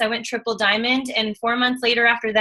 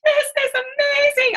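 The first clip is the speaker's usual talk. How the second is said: high and slow, a gushing mock squeal; thrilled; slower than usual. The couple reacting to their first big paycheck, with high, slow delight. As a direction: stretch it out in giddy disbelief.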